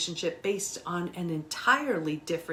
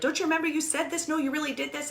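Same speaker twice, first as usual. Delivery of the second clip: higher, faster nagging accusation; mock accusing; 9 semitones higher, faster than usual. Betrayed partner confronting cheater with notes, mock accusing nag. Mocking arguing with pad and pen.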